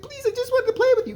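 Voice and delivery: very high, fast, desperate plea; hammed-up frantic little-girl voice; pleading little girl. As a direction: squeak out the begging like a scared kid. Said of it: "She's like".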